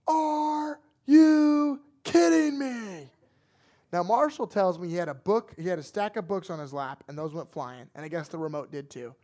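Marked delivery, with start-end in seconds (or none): 0.1-3.1 long drawn-out, high yell; dad's outraged yell. Retold or imitated. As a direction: bellow it slowly, word by word. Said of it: "I hear my dad say".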